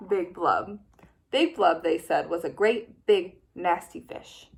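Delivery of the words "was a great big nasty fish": usual speaking voice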